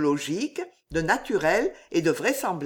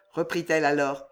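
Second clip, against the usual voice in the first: plain reading voice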